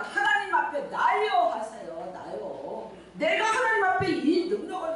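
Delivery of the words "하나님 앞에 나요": raised, emphatic declaration 하나님 앞에 나요; declare it. Shown as a demonstration. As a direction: proclaim 나요 with conviction. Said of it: "뭐라고 얘기하지 말고".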